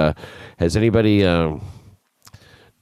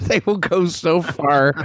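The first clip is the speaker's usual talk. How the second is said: laughing; 9 semitones higher, slower than usual. Acted. Repeats phrase laughing, higher.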